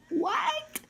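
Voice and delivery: a high, startled squeak of disbelief; shocked what. Retold or imitated. Dancer hearing she must teach, shocked high squeak. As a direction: caught completely off guard, yelp it.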